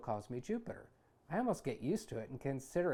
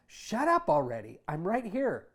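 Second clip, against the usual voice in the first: annoyed; 5 semitones higher, 8 dB louder than usual. Acted. Embarrassed son hushing his mother.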